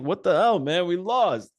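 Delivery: high, loud upset protest; a kid's loud complaint after a loss; upset kid yelling. Retold or imitated. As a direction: shout it like a frustrated kid. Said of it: "He's like".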